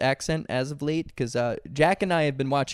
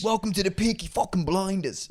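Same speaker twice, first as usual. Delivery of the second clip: high-pitched, badly faked British; bad British accent; 5 semitones higher than usual. Shown as a demonstration. Host failing at a requested accent, bad British. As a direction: attempt a Peaky Blinders British accent. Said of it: "I can't do a British".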